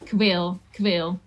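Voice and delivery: kwil, with the k of ik run straight into wil; kwil, joined like in speech. Shown as a demonstration. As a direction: say kwil as one word, dropping the i. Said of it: "we connect it to the next word".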